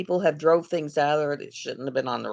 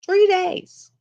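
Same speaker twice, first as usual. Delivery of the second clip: much higher, louder disbelieving Three days; amazed exclamation; 7 dB louder than usual. Shown as a demonstration.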